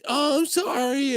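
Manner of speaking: high, slow meek sorry; a small, timid apology. Retold or imitated. Coworker apologizing as she leaves.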